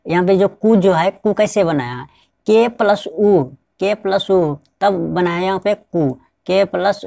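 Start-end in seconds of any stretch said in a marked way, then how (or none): none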